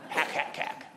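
soft fake hacking cough; mock nagging cough. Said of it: "have you ever had a nagging cough?".

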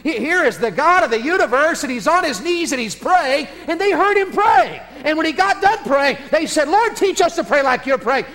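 high, loud impassioned sermon; fervent preaching. Acted. Preacher to congregation, full-force impassioned preaching. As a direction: lift the crowd with each line.

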